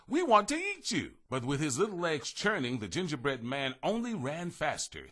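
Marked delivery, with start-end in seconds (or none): 0.1-1.1 put-on greedy children. A narrator's reading. Children chasing the cookie.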